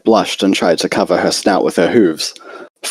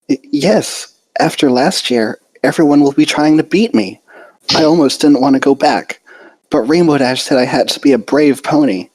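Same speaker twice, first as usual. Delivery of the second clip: nervous Fluttershy; 7 semitones higher than usual. A narrator's reading. Fluttershy worrying about rivals to her friends, nervous and higher. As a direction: stammer it out, anxious but brave.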